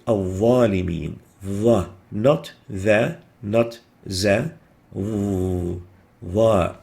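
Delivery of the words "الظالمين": الظالمين with a heavy, deep ظ; ظ مفخمة صحيحة; correct emphatic ظ. Shown as a demonstration. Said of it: "The proper way is to pronounce it".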